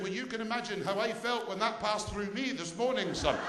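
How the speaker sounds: put-on high-pitched Scottish old lady; Scottish accent. Old lady answering her visitor's complaint, Scottish accent. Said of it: "I apologise for the accent".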